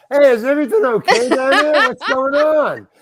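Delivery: high, loud call across the set; hollering over. Retold or imitated. Cinematographer calling to the huddled three, hollering over. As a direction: yell over to find out what's up.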